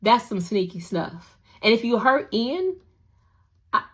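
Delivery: usual speaking voice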